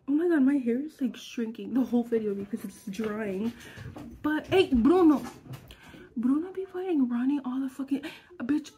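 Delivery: ordinary talk, nothing marked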